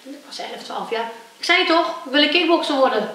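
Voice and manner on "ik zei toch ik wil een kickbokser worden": high, loud, indignant insistence; a kid's furious repeat; angry retort. Retold or imitated. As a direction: snap back, fed up at being laughed at. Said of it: "Toen zei ik heel boos".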